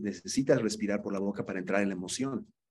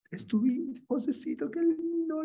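put-on much higher, cooing over a little boy; delighted cooing. Shown as a demonstration. Mourner meeting José's little son, delighted cooing. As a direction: melt with joy at the child. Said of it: "de alegría".

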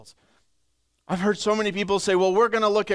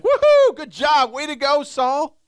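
high, loud whooping cheer; cheering soldier; 10 semitones higher, 7 dB louder than usual. Pretend. A peasant soldier loudly cheering King Saul. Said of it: "you'd be looking at the king and be like".

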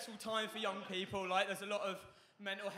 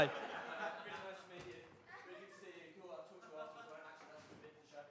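quiet aside; 10 dB quieter, faster than usual. Pretend. Comedian chats privately to his namesake, quiet aside. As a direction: drop right down for a quick aside. Mock private chat with audience member.

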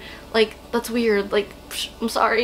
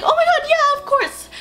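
much higher, thrilled gushing yes; excited squeal. Retold or imitated. Pop star gushing yes to an excited fan. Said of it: "And she was like".

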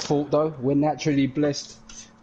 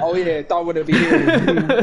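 laughing through the words, louder; 6 semitones higher, 6 dB louder than usual. Real, not acted. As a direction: giggle it out. Laughing at toilet joke.

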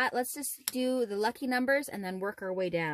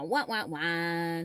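sad trombone wah wah; 5 semitones lower, slower than usual. Real, not acted. Losing trombone imitation.

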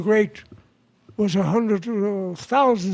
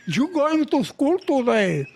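put-on higher-pitched, Spanish-accented maternal scolding; scolding Spanish mama; 5 semitones higher than usual. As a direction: lay down the law as a Spanish-accented mother. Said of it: "his mama says".

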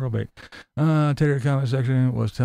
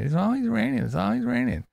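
high, whiny mock complaint; 9 semitones higher than usual. Vlogger mocking another YouTuber's rain complaints, whiny and high. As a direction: imitate him whining about rain.

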